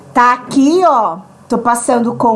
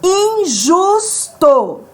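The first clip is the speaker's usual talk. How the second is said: coração and injusto stretched out slowly, syllable by syllable; slow teacher's example read; slow syllable demo; 3 semitones higher, much slower than usual. Shown as a demonstration. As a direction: draw each syllable out for students.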